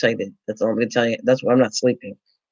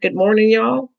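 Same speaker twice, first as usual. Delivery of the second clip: bored, offhand greeting imitation; mimicking generic greeting; 6 semitones higher than usual. Host mocking the chat's greeting to her, offhand. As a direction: toss off the greeting like you barely care. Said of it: "get a generic".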